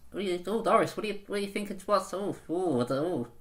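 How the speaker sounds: a hint of a muddled old lady. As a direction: lightly play a confused granny. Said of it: "It's just my old person voice".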